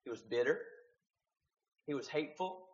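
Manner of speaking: ordinary talk, nothing marked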